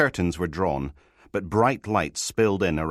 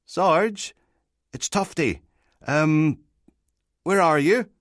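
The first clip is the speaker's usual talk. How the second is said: small, high-pitched hesitant caller; tentative, high little voice on the phone; wee whispery voice; 11 semitones higher, 5 dB louder, slower than usual. A narrator's reading. Tufty rings a sergeant in bed, wee whispery voice. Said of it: "a wee whispery voice".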